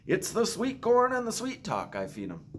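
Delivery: put-on high, lively old henman voice; Hubble the henman. A narrator's reading. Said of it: "Hubble said".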